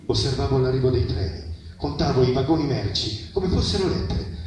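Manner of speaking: ordinary delivery, nothing marked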